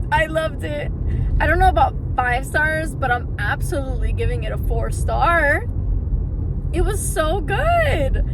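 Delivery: talking while driving over bumps; on a bumpy road. Real, not acted. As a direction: voice jolted by a bumpy car ride. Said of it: "I'm so sorry for the bumpy roads".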